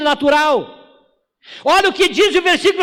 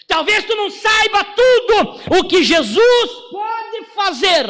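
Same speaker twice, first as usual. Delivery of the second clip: raised, driving sermon voice with shouted peaks; preaching at full force; 4 semitones higher than usual. Acted. Pastor urging the congregation the enemy knows, fervent preaching. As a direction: hammer it home to the church.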